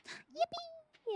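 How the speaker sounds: very high, long cheer; squeaky yippee. Real, not acted. Streamer cheering a viewer's gift, squeaky high yippee. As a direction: squeal it like a cartoon.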